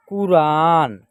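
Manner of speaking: कुरान with short कु, long रा; drawn-out model reading. Shown as a demonstration. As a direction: hold the आ in कुरान. Teacher models the blended word.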